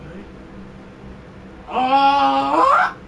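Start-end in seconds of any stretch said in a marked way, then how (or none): 1.7-3.0 higher "ah", jumping up at the end; drawn-out shouted ah. Real, not acted. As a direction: let out a long rising cry. Reacting to the game.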